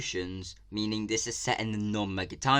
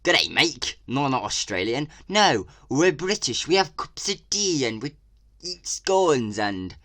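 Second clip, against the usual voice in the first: a lightly voiced clueless Brit, louder; mock English person; 4 semitones higher, 6 dB louder than usual. Vlogger mocking English characters in the game. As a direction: parody how the game's Brits talk. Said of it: "well, like".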